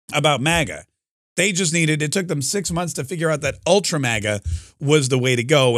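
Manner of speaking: usual speaking voice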